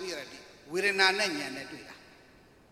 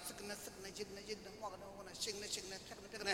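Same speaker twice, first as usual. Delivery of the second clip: very soft, under the voice; barely voiced repeated syllables; hushed rapid repetition; 2 semitones higher, 17 dB quieter than usual. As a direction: murmur the repeated words like private mental noting. Imitates rapid momentary change while noting.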